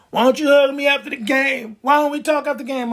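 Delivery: a touch of needy pleading; needy clingy voice. Acted. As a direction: beg for attention. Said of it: "he's like".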